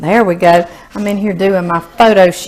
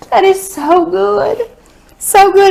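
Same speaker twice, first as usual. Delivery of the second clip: high delighted praise; 10 semitones higher than usual. Real, not acted. Tasting the scone.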